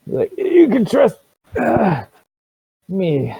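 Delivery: high-pitched, halting character voice; shopkeeper character. Acted. Shopkeeper on dealing with hags, high halting voice.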